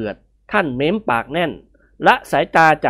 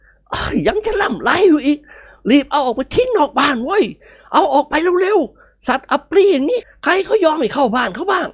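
higher, slow angry bark; roaring at him; 12 semitones higher than usual. Acted. Old master roaring at Nikorn to get it out. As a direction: drive him out with a scold. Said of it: "ตวาดลั่น".

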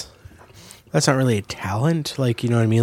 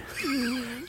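short high laugh; embarrassed laugh; 12 dB quieter than usual. Real, not acted. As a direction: laugh at yourself. After silly voice.

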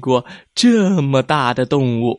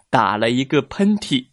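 plain reading voice

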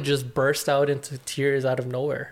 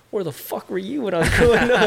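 laughing; 6 semitones higher, 5 dB louder than usual. Real, not acted. Laughing exasperated aside.

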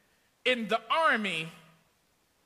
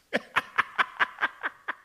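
airy laugh; 5 semitones higher than usual. Real, not acted. Laugh at the slight.

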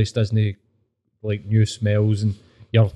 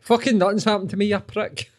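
lightly acted snappy comeback, pitched up; mock retort; 9 semitones higher than usual. Answering neighbours who pry, a mock retort. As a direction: fire it back at the nosy neighbour. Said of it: "you're like".

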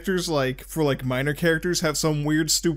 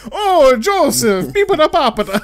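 overdone cartoon Italian accent, laughing; mock Italian accent; 9 semitones higher, 9 dB louder than usual. Caesar calls to Joseph, exaggerated Italian accent. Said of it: "some dude doing an accent".

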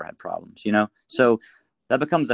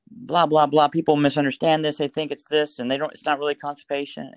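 raised pitch, louder, words tumbling out eagerly; super excited; 5 semitones higher, 3 dB louder than usual. Retold or imitated. Excitedly telling the doctor on the phone, super excited. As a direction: gush at him like you finally found your man. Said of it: "I was, like, super excited".

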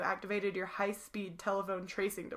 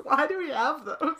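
silly character voice; 6 semitones higher, 7 dB louder than usual. Pretend. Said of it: "What's that voice?".

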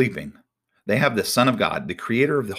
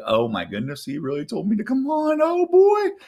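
disbelief climbing to a high squeal; hammed-up nervous amazement, pitch soaring; Peter; 13 semitones higher than usual. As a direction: start stunned, end in high-pitched panic. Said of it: "And Peter's like".

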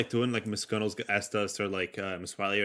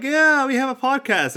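mock proud announcement; 13 semitones higher, 6 dB louder than usual. Imitating proud friend.

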